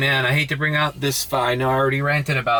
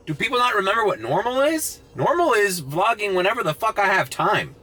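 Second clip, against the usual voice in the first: ordinary talk, nothing marked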